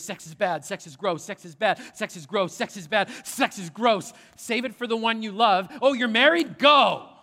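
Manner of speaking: repetitive chant building to a loud "Go"; hammed-up scolding that ends in a shout; mock church message. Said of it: "It went something like this".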